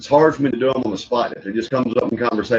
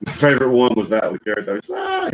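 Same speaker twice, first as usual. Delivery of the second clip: ordinary delivery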